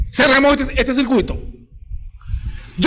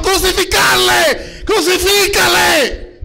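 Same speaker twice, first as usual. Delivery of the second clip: higher, slow, shouted chant; crowd yelling; 6 semitones higher, slower than usual. A narrator's reading. Preacher acting the crowd calling for crucifixion, slow shout. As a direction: roar it as a frenzied mob. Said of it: "volvieron a dar el grito".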